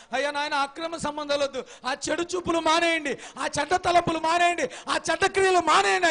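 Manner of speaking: from firm to a high, loud cry; building pleading shout. Acted. Preacher begging the congregation to give up sins, rising to a shout. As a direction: plead harder with each line till shouting.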